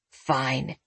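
low, soft grudging mutter. A narrator's reading. Man refused another brownie, muttered. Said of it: "he muttered".

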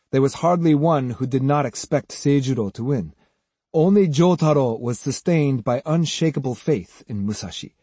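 ordinary delivery, nothing marked

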